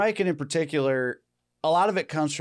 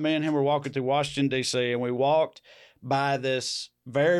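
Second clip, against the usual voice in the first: usual speaking voice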